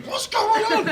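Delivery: very high, loud, laughing cry of confusion; laughing disbelief. Retold or imitated. Singer turning to the band, laughing loud high disbelief. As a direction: laugh it out in total bewilderment. Baffled at a silent crowd.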